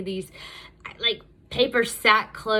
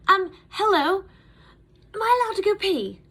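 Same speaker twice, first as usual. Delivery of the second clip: put-on much higher, louder, meek little-girl ask; timid asking; 12 semitones higher, 6 dB louder than usual. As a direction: peek round and ask sheepishly. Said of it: "and be like".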